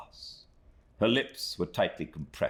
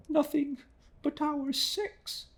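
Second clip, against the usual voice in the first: put-on much higher, slow, tearful young woman; bursting into tears; slower than usual. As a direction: answer through rising tears. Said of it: "with a burst of tears".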